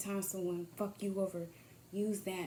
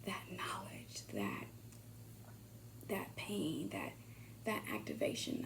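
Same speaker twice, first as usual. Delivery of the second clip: whisper; 3 semitones lower, 10 dB quieter than usual. Pretend. Whispered thank-you to those who hurt.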